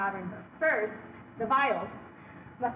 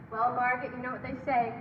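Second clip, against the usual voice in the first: ordinary talk, nothing marked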